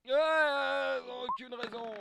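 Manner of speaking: very high, drawn-out holler; loud outburst. Real, not acted. Player reacting loudly to game master's dice request, hollering. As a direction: holler it, stretching the first word.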